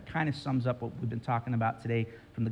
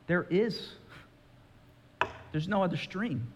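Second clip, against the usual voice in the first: lion's quiet verdict; 5 semitones higher, 3 dB quieter than usual. Retold or imitated. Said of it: "says the lion".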